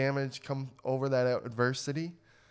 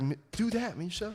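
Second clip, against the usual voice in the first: cheering voice; 7 semitones higher, 4 dB quieter than usual. Retold or imitated. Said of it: "It's like".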